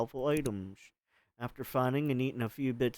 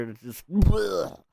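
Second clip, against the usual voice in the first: mock gag; 3 semitones higher, 6 dB louder, much slower than usual. Imitating gagging.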